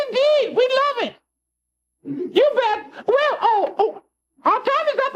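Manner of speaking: very high, fussy English impresario; squeaky impresario voice. Acted. Kukla plays the impresario on the prank phone call, very high. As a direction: pipe up high as a theatrical impresario. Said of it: "our impresario would like a word".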